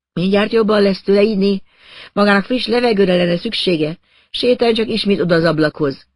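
a pushy woman cooing him toward the window; coaxing woman. Acted.

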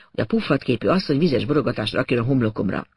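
ordinary delivery

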